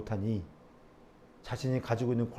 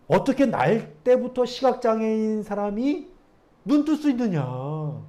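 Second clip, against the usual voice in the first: higher, slow disbelieving challenge; slow, raised mock disbelief; incredulous scoff; 10 semitones higher, 8 dB louder, slower than usual. Pastor mimicking the Pharisees' doubt, mock incredulous.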